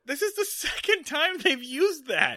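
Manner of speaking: talking through high laughter; laughing. Real, not acted. Joking with her co-host about the trigger word, laughing hard. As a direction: crack up while you say it.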